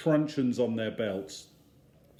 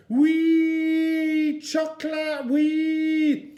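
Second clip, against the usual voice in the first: high, loud, sing-song squeal of glee; toddler squealing; 7 dB louder, much slower than usual. Acted. Eddie grabbing a packet of chocolate wafers, squealing with glee. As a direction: squeal with delight like a small child.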